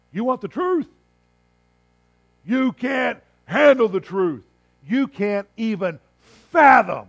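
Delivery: high, loud, slow, intense outburst; movie line impression. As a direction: yell it like a courtroom showdown. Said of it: "reminds me of the old".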